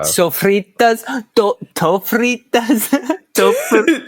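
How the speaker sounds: high, drawn-out mock Italian accent. Co-hosts fumbling the name of a burrito filling, Italian accent. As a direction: question it in a hammy Italian accent. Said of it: "saying that with an Italian accent".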